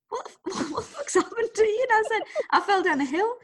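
ordinary delivery

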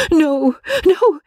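very high, frantic protest; wild cry. Acted. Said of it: "cried Marianne wildly".

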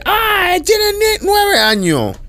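high, drawn-out mock mother's whine; squealed mock excuse for her child; protesting mother.

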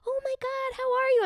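very high-pitched gushing hello; mock baby-talk greeting. Imitating relatives greeting nieces and nephews, high baby talk. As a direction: coo at a little kid, way up high. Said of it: "like, everyone else comes in".

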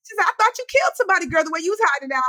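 high, fast teasing friend. Retold or imitated. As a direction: rib her for hiding. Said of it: "She's like".

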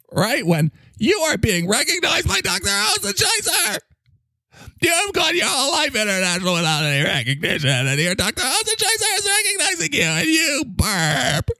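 high wailing, melodramatic scolding; overwrought outrage. Acted. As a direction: wail with indignation, dragging out the end. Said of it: "You're really feeling a lot".

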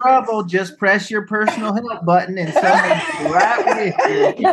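mock care worker voice. Mock nursing home staff.

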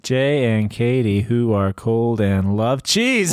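slow, drawn-out syllable-by-syllable scansion; metrical scansion. Shown as a demonstration. Co-host testing whether a name is pentameter, slow scansion. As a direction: stretch each beat to test the meter. Sounding out the meter.

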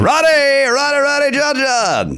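high, ringing call. Acted. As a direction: call out across the room. Calling out to someone.

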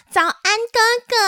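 put-on high, slow coy girly voice; cutesy girl voice. (撒娇的女生声音)

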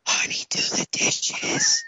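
put-on high breathy little girl voice; creepy Poltergeist girl whisper; man doing a woman's voice. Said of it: "[name] sounds like the girl from Poltergeist".

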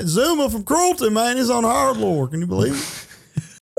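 high-pitched Texas twang; Southern accent. Shown as a demonstration. Host showing guest the Texas accent, high Southern accent. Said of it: "The Southern accent".

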